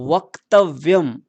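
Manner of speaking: वक्तव्यम said slowly, half क and half व joined clearly; careful pronunciation. Shown as a demonstration. Teacher models वक्तव्यम for children, slow and careful. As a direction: spell out वक्तव्यम syllable by syllable, slow. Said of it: "जरा आप इसका सही उच्चारण कीजिए बच्चों".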